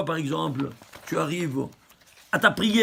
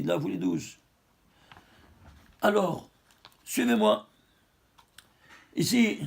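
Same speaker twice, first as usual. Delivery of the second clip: usual speaking voice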